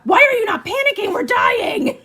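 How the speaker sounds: high, loud panicked shriek; shrieking anxiety. Retold or imitated. Anxiety tapping her shoulder, shrieking high and loud. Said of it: "anxiety taps shoulder".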